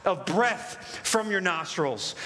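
psalm read aloud, climbing to a high driving peak; emphatic, rolling Bible reading; dramatic scripture reading. Acted. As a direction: build from measured reading to ringing proclamation.

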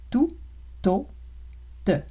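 Tu, to, te said with a clean T; no hiss after the T in to and te; plain t. Shown as a demonstration. Teacher contrasting tu with plain to, te. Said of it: "on prononce ta".

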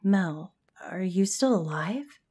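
ordinary delivery

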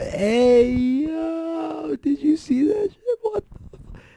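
very high, loud New York yelling; screaming New York fans amazed; New York hype. Retold or imitated. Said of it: "'cause it's New York City".

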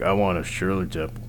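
low gruff voice asking for a Shirley Temple; tough guy ordering a Shirley Temple. Pretend. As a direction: growl the kid's drink order like a tough guy. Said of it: "You never hear that voice that order that drink".

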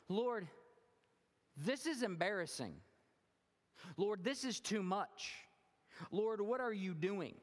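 high, loud, slow pleading protest; embarrassed Peter. Acted. Peter begging Jesus to stop, embarrassed and pleading. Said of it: "where Peter's going".

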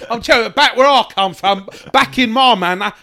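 hammed-up Cockney hard man laying into him; mock Cockney geezer rant. As James Caan berating the hungover actor, mock Cockney fury.

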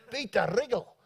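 imitated South African accent on the name. Colleague recognising a name, South African accent. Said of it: "doing my South African accent".

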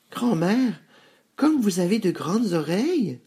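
put-on high, wondering little-girl voice; high-pitched child. A narrator's reading. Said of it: "dit-elle".

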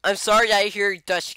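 normal talking voice

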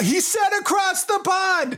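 high, amazed New York caricature; giddy imitation of a New York accent; mock New York accent. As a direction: squawk it in broad New Yorkese. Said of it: "if one of our accents went over there".

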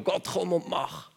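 high, slow begging call; pleading cry to God. Retold or imitated. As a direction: beg God like a helpless prophet. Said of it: "Er hat zu Gott geschrien". (hoch, langsam und flehend)

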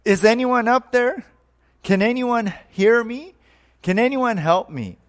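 high-pitched call upward for help; acted yell. Man hanging from a branch calls for help, mock yelling. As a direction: call out to someone far above you. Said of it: "he looked up and yelled".

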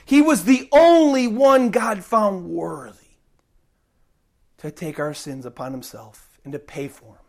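ordinary delivery

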